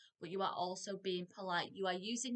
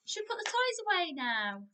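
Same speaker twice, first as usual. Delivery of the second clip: parent asking; 9 semitones higher, 7 dB louder than usual. Shown as a demonstration. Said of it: "have any of you said".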